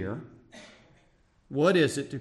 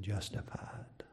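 soft, slow whisper of grace; whispered verdict; 4 semitones lower, 12 dB quieter than usual. Acted. God whispering his verdict on the humble publican. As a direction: breathe the word gently. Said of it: "God said".

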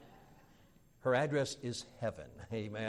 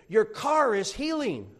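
mock solemn diagnosis; 8 semitones higher, 7 dB louder, slower than usual. Mechanic teasing the preacher.

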